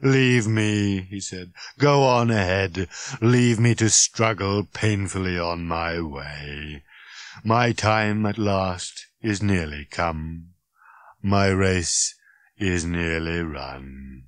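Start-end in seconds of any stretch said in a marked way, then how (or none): 0.0-1.0 droning martyred robot voice; worn-out, dreary monotone; weary robot. Acted. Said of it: "He rasped his way through a coughing fit". 1.8-14.2 slow, doleful robot farewell; dreary drone sinking lower and slower; dying robot. Acted. Dying robot bids them leave.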